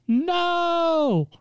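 long, high drawn-out NO; mock anguished wail, held long and high; anguished cry. Retold cry over fallen sister.

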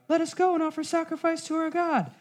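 high, mocking whiny voice of the complainers; a high falsetto parody of a plea; mocking whine. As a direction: mimic them in a squeaky sneer. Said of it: "Therefore they cry".

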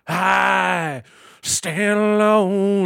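high, loud, drawn-out rock wail; [name] singer impression. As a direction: belt it like a [name] frontman. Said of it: "what they want is me being like".

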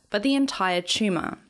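ordinary delivery, nothing marked

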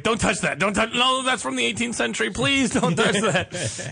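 fussy, pleading curator voice. Retold or imitated. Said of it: "He's like".

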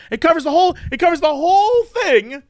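high, exasperated argument; emphatic insistence. Real, not acted. Heated argument about word coffee.

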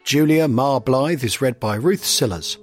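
ordinary delivery, nothing marked

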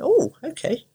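high-pitched, mildly startled oh; retold surprised reaction, pitch jumping up; taken aback. Retelling her reply to the mum's story, taken aback.

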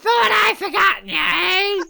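very high, wailing put-on voice; hammed-up high cry; mock wife voice. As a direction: squeal it in a silly mock voice.

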